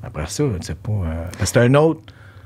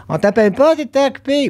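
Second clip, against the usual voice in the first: quick, high-pitched acted quote; mock excuse; 13 semitones higher than usual. Clients explaining why they stopped calling, high mock excuse. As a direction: rattle it off high, imitating the callers.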